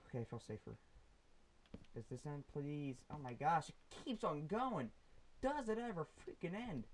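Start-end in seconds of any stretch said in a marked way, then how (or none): 3.1-6.8 rising, exasperated complaint, higher pitched. Real, not acted. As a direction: complain loudly that it never stops. Fed up with endless cave.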